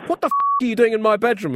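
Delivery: fast, high, loud outburst despite the gentle tag; gently. Acted. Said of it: "he said gently".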